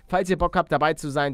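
ordinary talk, nothing marked

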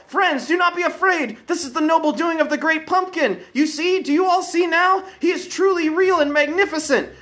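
put-on a child begging his idol; eager young boy. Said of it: "Linus called to them all".

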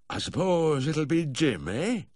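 slow, high-pitched tipsy slur; tipsy drawl, pitched well up; slurred drunk. A narrator's reading. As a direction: slur it like a benign old drinker. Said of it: "he slurred".

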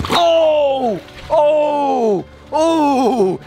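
high, loud startled cries; surprised shouts. Real, not acted. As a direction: yelp at each strike. Fish strikes at the bait.

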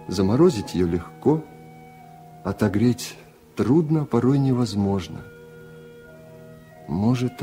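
usual speaking voice

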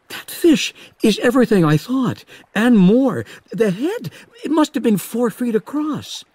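high, eager voice full of wonder; excited young Hooper. Acted. Hooper marveling to Quint about the shark, high and eager.

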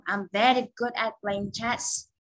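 said slowly and clearly; slow model answer. Shown as a demonstration. Teacher models a sample answer slowly. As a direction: read the answer slowly for the class. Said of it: "Ví dụ".

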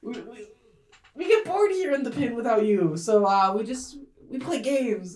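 caught-out little creature. Acted. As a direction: stammer out an innocent excuse. Mushroom bully covers up to Ivy.

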